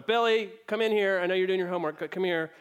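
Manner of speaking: mock summons of the older son; calling out.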